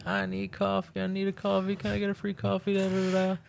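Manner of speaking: high-pitched whiny begging, a mock yell without volume; a friend mimics a pushy stranger begging for coffee, high and whiny; imitating a pestering woman. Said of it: "just starts, like, yelling".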